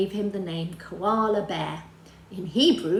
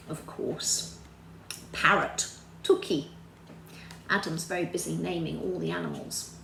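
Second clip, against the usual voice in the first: whisper; 3 semitones lower, 5 dB quieter than usual. Acted. Aside whispered to child.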